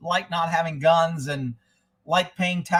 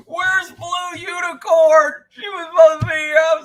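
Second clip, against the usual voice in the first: calling out; 11 semitones higher, 9 dB louder than usual. Real, not acted. Host loudly calls for absent viewer Blue Unicorn. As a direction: shout as if hunting for a missing friend.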